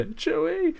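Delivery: high-pitched mimicking cry; mock bad line delivery. Streamer mocking an actress's delivery in the death scene, high mock cry. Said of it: "the way she delivers that".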